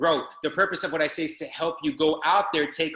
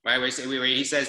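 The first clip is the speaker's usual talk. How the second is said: normal talking voice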